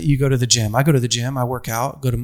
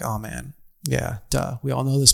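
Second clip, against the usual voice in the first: much softer, throwaway imitation of the crowd; soft, dismissive aside in the crowd's voice; mock audience shrug; 2 semitones lower, 7 dB quieter than usual. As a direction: mumble it like a bored listener. Mocking audience's dismissive reply.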